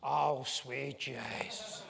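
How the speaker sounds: slow, drawn-out cabbie voice, lightly put on; imitating an amazed Dublin cabbie, slowly; Dublin taxi driver. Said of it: "and he goes".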